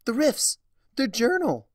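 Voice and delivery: high, loud sudden realisation; startled cry of remembering; gasped alarm. A narrator's reading. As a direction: blurt it out in alarm. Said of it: "Twilight gasped".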